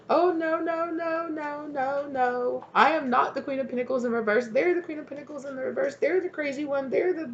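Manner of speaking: shrill-high, fast protesting voice; high indignant denial. Acted. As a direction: deny it all in a high squeal. Acted denial of the rival.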